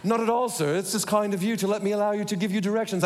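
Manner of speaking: polite British butler, pitched high; brisk, courteous British servant voice; British phone voice. Acted. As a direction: put on a British butler's accent. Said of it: "with my British talking phone".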